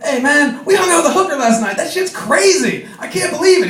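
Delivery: high, buzzing with excitement; giddy high voice spilling gossip to a friend; excited retelling. Retold or imitated. Excitedly retells night to Patrick.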